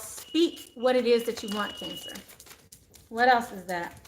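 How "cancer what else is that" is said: normal talking voice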